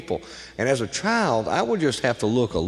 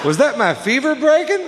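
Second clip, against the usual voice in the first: squeaky high innocent question with a laugh; high mock innocence; 12 semitones higher than usual. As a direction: play dumb in a high little voice. Punchline in childlike voice.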